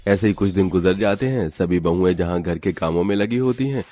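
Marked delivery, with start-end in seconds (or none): none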